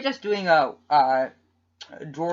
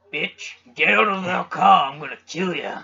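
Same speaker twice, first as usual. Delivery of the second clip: mock angry threat; 5 dB louder than usual. Vigilante confronting a driver who hit the car, mock angry threat. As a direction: play-act a drawled-out road threat.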